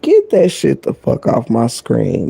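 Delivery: ordinary talk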